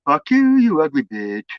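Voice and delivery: playful mock insult in a loving tone; sweet-sounding insults. Shown as a demonstration. To his wife, insults said with an affectionate tone. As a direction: say nasty words like sweet nothings. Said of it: "it's not what you say, it's how you say it".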